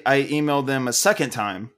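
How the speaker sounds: usual speaking voice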